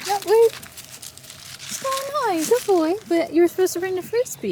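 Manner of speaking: high playful voice for the dog; pet talk. Real, not acted. Talking to the dog.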